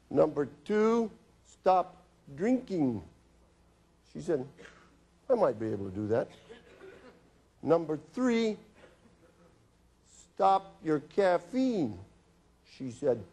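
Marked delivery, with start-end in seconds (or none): 0.1-3.0 slow, weighted, every word laid down; slow firm prescription. Retold or imitated. Doctor reading his prescription to the nervous patient, slow and firm. 5.3-6.3 laughing. Retold or imitated. Patient half agreeing. 7.6-12.0 slow, weighted, every word laid down; slow firm prescription. Retold or imitated. Doctor reading his prescription to the nervous patient, slow and firm.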